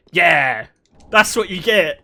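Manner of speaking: loud, punchy mock victory; retold triumphant taunt. Brother standing over his sisters after forcing the door, loud gloating. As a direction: bark it out like a kid getting even.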